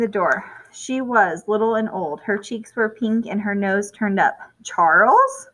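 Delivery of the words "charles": excited cry. Acted. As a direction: cry out his name in joyful surprise. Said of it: "she cried".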